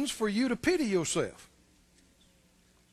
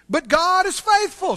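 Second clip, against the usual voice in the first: higher, louder ringing declaration; proclaiming; 7 semitones higher, 6 dB louder than usual. Real, not acted. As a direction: proclaim it boldly. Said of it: "Somebody say".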